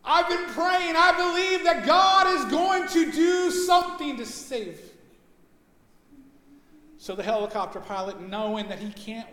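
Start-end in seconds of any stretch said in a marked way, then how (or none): 0.0-4.8 much higher, straining upward; strained refusal. Retold or imitated. Man on roof refusing the helicopter, high strained cry. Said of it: "and he says".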